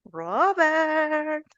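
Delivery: "Robert" alto y cantado; llama a Robert en voz alta y aguda; llamando al alumno. Shown as a demonstration. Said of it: "yo le digo a mi alumno". (llamada juguetona en clase, alta y cantada)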